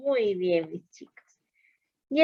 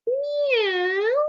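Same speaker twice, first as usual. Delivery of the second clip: cat meow; 13 semitones higher than usual. Shown as a demonstration. Teacher voicing cat sound.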